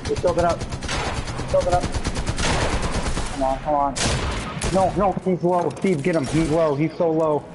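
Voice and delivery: high urgent callouts; excited callout. Real, not acted. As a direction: call it out fast and urgent. Tense fight, calling out enemy.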